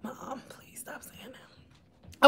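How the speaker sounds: hushed, slow whisper; soft slow whispered "stop"; whispered plea. Retold or imitated. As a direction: whisper it like a pleading kid. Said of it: "my daughter just was like".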